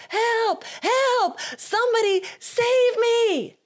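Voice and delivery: high, loud mock cry for help; hammed-up scream for help as lions charge; retold yell for help. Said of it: "he yells".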